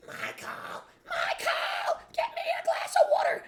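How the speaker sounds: very high, loud screeching imitation of his mother; mocking his nagging mother with a high, loud screech; screeching mom. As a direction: screech it like a barking chihuahua. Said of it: "she would be screeching in the background like a banshee".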